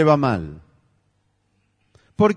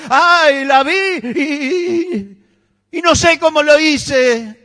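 much higher, louder whining voice; mock whiny excuse; 10 semitones higher, 5 dB louder than usual. Preacher mimicking a believer excusing a sin, whiny. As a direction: play the helpless sinner, whining.

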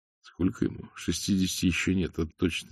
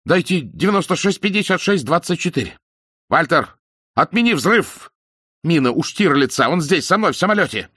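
very high, loud call; frantic shout; 10 semitones higher, 7 dB louder than usual. A narrator's reading. Desperate man shouting into the microphone. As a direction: yell into the radio. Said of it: "закричал Айсман в микрофон".

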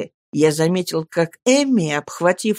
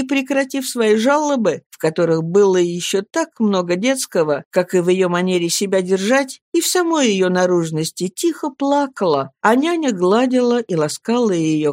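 ordinary delivery

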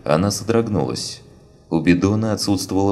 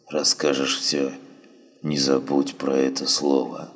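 dying wounded man; 4 dB quieter than usual. Acted. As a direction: barely get the words out, fading. Dying man speaking faintly.

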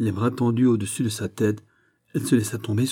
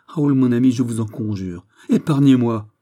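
anguished plea, bursting out loud; imploring cry; 4 semitones higher, 9 dB louder than usual. A narrator's reading. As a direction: beg to be spared, crying out at the end. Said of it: "s'écria la Vallière". (cri suppliant)

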